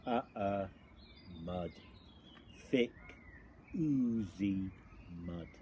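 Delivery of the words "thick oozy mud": usual speaking voice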